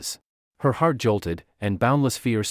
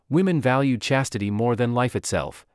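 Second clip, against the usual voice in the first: ordinary delivery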